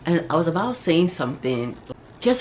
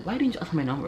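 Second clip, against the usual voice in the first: mildly upset; 3 semitones higher, much faster than usual. Acted. Woman upset her crush didn't ask for her number, mildly. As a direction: complain with a touch of grievance. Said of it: "I kinda got upset again".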